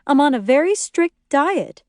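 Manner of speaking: lightly voiced insistent mother; strict dieter. A narrator's reading. Lori explains her diet primly.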